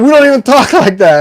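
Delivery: high, loud mock protest; indignant retort. New Yorkers objecting to his impression, high loud mock protest. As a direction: push it up high and loud, imitating offended locals. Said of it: "they're like".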